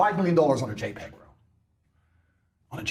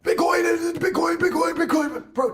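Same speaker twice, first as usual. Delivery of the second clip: imitating a yelling crypto guy; 11 semitones higher, slower than usual. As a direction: ham up the crypto YouTuber who yells nonstop. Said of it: "one guy who yells all the time".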